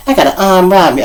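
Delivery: ordinary talk, nothing marked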